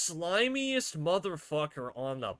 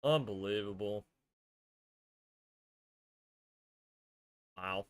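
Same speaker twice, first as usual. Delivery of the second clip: low, soft, sparse remarks; stunned dejection; 7 semitones lower, 6 dB quieter than usual. Real, not acted. Fan taking in another Peterbot win, quietly dejected. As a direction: drop each word quietly, beaten. Sitting stunned after the loss.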